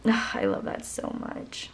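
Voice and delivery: normal talking voice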